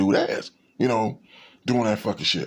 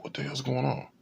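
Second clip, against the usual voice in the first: muttered to himself; 3 semitones higher, 7 dB quieter than usual. Retold or imitated. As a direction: say it softly to yourself, uneasy. Thinking while being followed.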